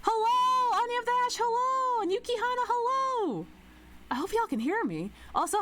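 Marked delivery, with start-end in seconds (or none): 0.0-3.5 excited greeting. Real, not acted. Greeting raiders.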